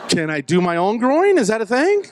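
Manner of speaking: high, climbing nervous question; nervous pleading. Acted. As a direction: squeak out an awkward request, voice climbing. Nervously bargains with the nurse.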